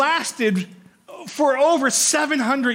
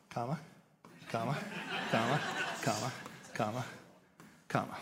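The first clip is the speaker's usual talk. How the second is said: soft counting; 11 semitones lower, 17 dB quieter, much faster than usual. Shown as a demonstration. Marking commas in the number.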